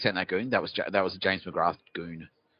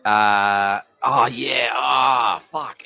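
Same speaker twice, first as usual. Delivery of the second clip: sudden realization; 3 semitones higher, 7 dB louder, much slower than usual. Real, not acted. Sudden realization mid-story.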